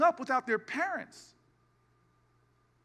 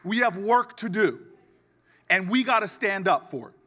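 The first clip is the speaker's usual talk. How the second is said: much louder, booming rally call; rally preaching; 3 semitones lower, 7 dB louder than usual. Acted. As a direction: lift the crowd to its feet. Rally close.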